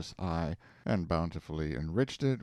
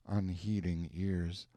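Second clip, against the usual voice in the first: usual speaking voice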